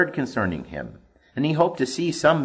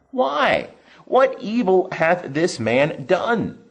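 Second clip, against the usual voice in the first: incredulous; 9 semitones higher, 4 dB louder, slower than usual. A narrator's reading. Pilate pleads with the crowd.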